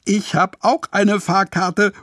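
viel höher, stolz herausrufen; aufgeregt rufen. Acted. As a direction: mit der Fahrkarte laut prahlen. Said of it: "ruft Jojo". (Jojo ruft stolz dazwischen, dass er auch eine Fahrkarte hat)

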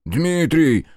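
a frightened warning shout; alarmed cry. A narrator's reading. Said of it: "воскликнул ... встревоженный Кормушин".

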